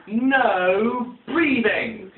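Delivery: slow, drawn-out stern command from a strict teacher. Retold or imitated. Strict teacher to the class, slow stern drawn-out command. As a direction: stretch every word out like a teacher who forbids breathing. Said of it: "So once more, from the beginning".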